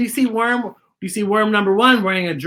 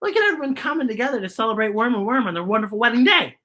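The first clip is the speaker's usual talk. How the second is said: usual speaking voice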